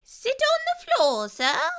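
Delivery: very high, loud squeaky mouse voice; a tiny polite mouse dentist; little mouse speaking up. A narrator's reading.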